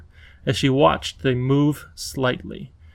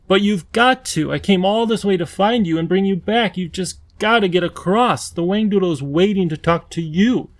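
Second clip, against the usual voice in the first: put-on young girl, higher and urgent; eager young girl; 5 semitones higher than usual. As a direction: pull him along, eager and pleading. Lindy pleading.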